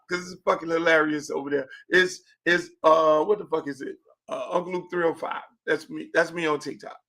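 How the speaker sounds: usual speaking voice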